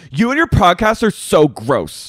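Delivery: loud, high mock accusation; confrontational. Acting out Katie confronting Sheena, confrontational. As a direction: hurl it as an acted-out confrontation. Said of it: "Katie finally confronts Sheena".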